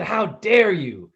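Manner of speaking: mock aristocratic indignation, drawn out; elegant outrage. Little [name] scolding his prankster brother, grand and slow. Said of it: "I was like the elegant".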